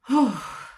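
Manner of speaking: heavy sigh, out of air; breathless sigh. Real, not acted. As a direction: let out a winded sigh. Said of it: "Sorry, I'm out of breath".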